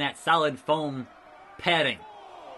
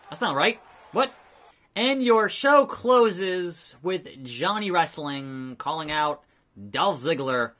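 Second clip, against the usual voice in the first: ordinary talk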